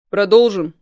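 hard, loud demand; harsh. A narrator's reading. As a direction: bark the question coldly. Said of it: "жёстко спросил я".

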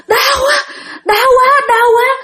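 very high pained wail; crying out in pain. Retold or imitated. Spirit stabbed through the straw doll, pained cries. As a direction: act out sudden hurt. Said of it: "Xong cái bắt đầu la lên".

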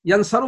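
ينصر pronounced "yansaru"; fatha on the middle letter. Shown as a demonstration. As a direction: give ينصر an a vowel, yan-sa-ru. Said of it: "ما ورد". (نطق ينصر بفتح العين: يَنْصَرُ)

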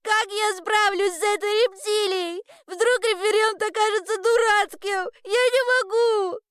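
put-on high-pitched, upset girl's voice; distressed little girl. Girl tells the weaver she cannot cope, high and distressed. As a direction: wail in a high child's voice.

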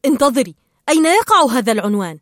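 loud, quick cut-in; interrupting. A narrator's reading. Marlin interrupts Dory's reciting, urgent question. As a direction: break in briskly with a loud question. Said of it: "إلا أن مارلين قاطعها".

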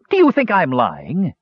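mild high-pitched narrated shout; indignant shout. A narrator's reading. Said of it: "he shouted".

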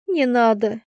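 usual speaking voice

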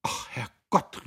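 langsam, gehaucht erschrocken; plötzlich an die Zeit denken; erschrockener Ausruf. A narrator's reading. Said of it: "rief Guste plötzlich". (gehauchter erschrockener Ausruf)

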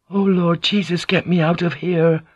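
high, frightened pleading; panicky prayer, building. A narrator's reading. Prisoner cracking in the pit, panicky pleading. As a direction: pray in mounting panic. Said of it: "Croak's voice started to crescendo".

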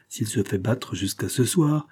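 usual speaking voice

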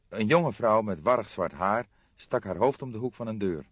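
ordinary delivery

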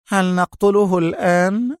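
high, sarcastic question. A narrator's reading. Rachel taunting Sonia about the prisoner, sarcastically. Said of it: "فقالت لها راشيل في سخرية".

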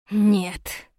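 understated growled refusal; a mild growl of refusal. A narrator's reading. Prince refuses his general's proposal, mildly growling. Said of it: "прорычал Сорин".

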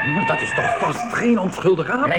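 startled shout in the night forest; frightened cry. Acted. Fright at the eyes in the dark.

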